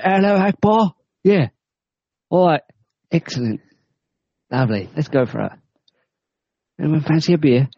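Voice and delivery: high, chirpy London geezer voice; mock cockney jihadi. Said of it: "How do you say it again".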